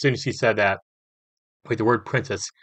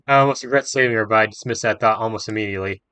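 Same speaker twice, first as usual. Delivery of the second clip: usual speaking voice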